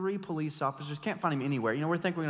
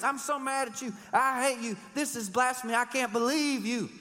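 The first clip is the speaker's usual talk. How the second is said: mock anger; 9 semitones higher than usual. Acted ancient church quarrel.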